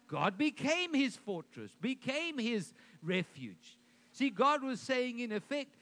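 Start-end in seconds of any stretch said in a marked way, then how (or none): none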